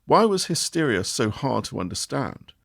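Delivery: ordinary delivery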